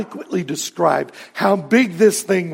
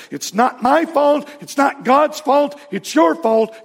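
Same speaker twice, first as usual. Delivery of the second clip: higher, insistent Southern preacher voice; blaming faith healer; 8 semitones higher than usual. Retold or imitated. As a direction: push the fault back onto them, high and pointed. Faith healer shifting blame onto sick.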